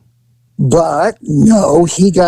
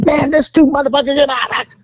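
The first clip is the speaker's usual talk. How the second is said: high, put-on voice of a frantic woman; high mock woman caller; 9 semitones higher, faster than usual. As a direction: squeal it like the caller complaining. Retold woman on 911 call.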